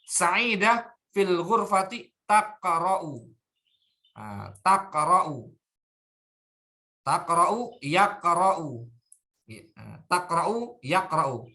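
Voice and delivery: "taqra'u" and "yaqra'u" said slowly, each first syllable clear; contrast taqra'u, yaqra'u. Shown as a demonstration. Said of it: "intabihu al-farq huna". (tekankan perbedaan "taqra'u" dan "yaqra'u")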